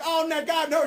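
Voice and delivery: dismayed wife. Retold or imitated. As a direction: play the upset wife reaching out. Wife voiced in story.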